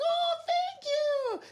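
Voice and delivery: high, slow, drawn-out thank you; imitating a mom. Mom thanking him for the compliment, high drawn-out delight. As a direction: play the flattered mom, high and stretched. Mom voiced in retold story.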